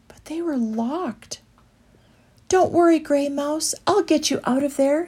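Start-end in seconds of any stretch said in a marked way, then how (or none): 2.5-5.1 higher reassuring promise; promising. A narrator's reading. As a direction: comfort the little mouse. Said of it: "he promised".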